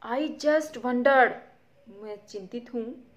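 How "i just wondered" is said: nervous. Acted. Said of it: "थोड़ी चिंतित है, नर्वस है".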